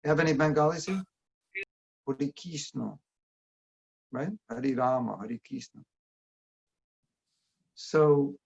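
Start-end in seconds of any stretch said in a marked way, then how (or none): 2.1-3.0 soft Bengali "Hare Krishna", like "Hori Kisno"; "Hare Krishna" said the Bengali way, quietly; Bengali pronunciation. Shown as a demonstration. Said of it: "The Bengalis say". 4.5-5.8 "Hare Krishna" as "Hori Kisno"; Bengali-sounding "Hare Rama", "Hare Krishna"; Bengali pronunciation. Shown as a demonstration. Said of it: "The Bengalis say".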